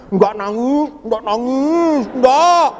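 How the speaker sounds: put-on a small boy swallowing his tears; hurt child insisting he isn't crying; child fighting tears. Child holding back tears.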